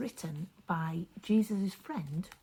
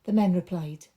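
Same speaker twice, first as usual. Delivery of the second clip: lively, expressive storytelling voice; story narration for children; 8 dB louder than usual. A narrator's reading. Storyteller reading a Bible story aloud to children, animated. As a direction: paint the story big for the kids.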